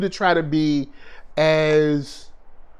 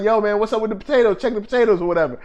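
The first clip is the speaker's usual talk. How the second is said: retold quick call; 3 semitones higher, much faster than usual. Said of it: "Remember when I was like".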